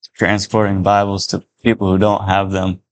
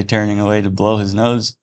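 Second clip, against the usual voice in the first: usual speaking voice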